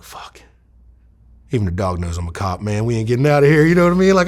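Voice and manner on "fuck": very soft swear under the breath; barely voiced curse to oneself; hushed dismay. Retold private dismay at dog.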